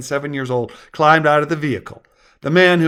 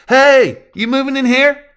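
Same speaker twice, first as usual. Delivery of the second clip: put-on high, loud kid voice; ten-year-old child; 11 semitones higher, 8 dB louder than usual. A narrator's reading. Child calling to new neighbor, curious kid voice.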